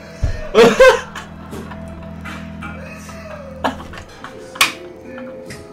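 shrill breathy laughter; high squealing laugh. Real, not acted. Laughing at unicorn head voice.

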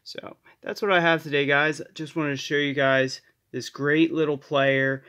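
ordinary talk, nothing marked

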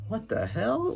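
high, hushed startled question; startled what the hell. Retold or imitated. Kid imitating Jared hearing a noise, hushed and startled. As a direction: gasp it out, caught off guard. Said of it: "He was like".

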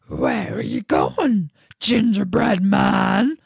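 loud, slow bear voice; big bear voice. Acted. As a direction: boom it out like a bear. The bear speaks.

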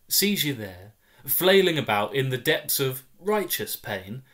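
usual speaking voice

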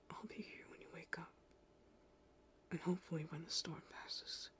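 soothing whisper, lulling someone to sleep; hushed bedtime reassurance during a storm; soft whisper. Acted. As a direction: breathe the words gently, no voice at all. Whispers comfort through the storm.

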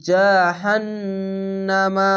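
জাহান্নামা very slow with the doubled nun hummed long; long chanted জাহান্নামা; tajweed recitation. Shown as a demonstration. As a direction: stretch জাহান্নামা, holding the nasal নুন. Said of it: "এখানে ওয়াজিব গুণনা".

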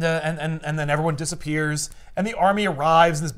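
ordinary delivery

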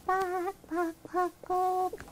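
high, hushed little clucks; soft hen clucking. Acted.

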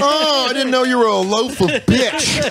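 high, put-on mocking female voice, laughing through it; imitating Bonnie. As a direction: squeal it out like a teasing woman. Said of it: "she's like".

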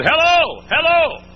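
loud, high calling hello. Retold or imitated. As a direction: call it out to whoever's inside. Calling out at a country church.

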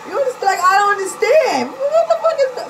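high, loud baffled shout with a laugh; high laughing outcry of confusion; incredulous outcry. Real, not acted. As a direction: yell in disbelief, laughing. Venting disbelief, laughing.

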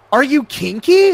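high, loud, pointed question; mock probing question; imitating the woman. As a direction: pitch it up as her, prying. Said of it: "She was like".

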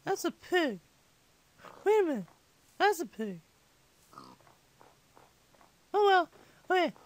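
put-on little kid gamer voice; five-year-old impersonation. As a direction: chat to viewers like a squeaky young child. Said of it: "I speak so much in this voice".

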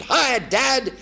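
bright high greeting; chirpy call to dad; cheery hello. Retold or imitated. Son greeting father cheerfully.

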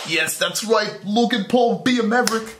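high proud fan cheer; high cheering for Logan Paul; excited cheer. Real, not acted. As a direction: hype him up, pitched high.

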